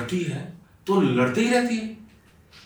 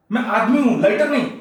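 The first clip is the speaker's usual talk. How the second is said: higher-pitched, indignant retort; exasperated protest; 4 semitones higher, 4 dB louder than usual. Acted. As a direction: protest that this is absurd. Husband protests at spark complaint. (चिढ़कर ऊँचे सुर में जवाब)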